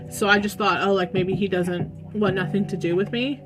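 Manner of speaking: ordinary delivery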